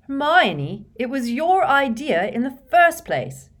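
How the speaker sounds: loud, high-pitched indignant protest. A narrator's reading. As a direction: throw it back at her in outrage. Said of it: "said Ron indignantly".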